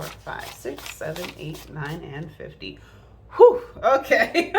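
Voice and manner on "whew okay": slow, loud relieved whew into a laugh. Real, not acted. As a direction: blow out relief, then laugh. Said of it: "Sorry about that. I confused myself".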